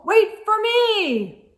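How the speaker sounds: slow, high, loud call; calling after. A narrator's reading. Sheep calls for the others to wait, loud. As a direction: call after them, drawn out.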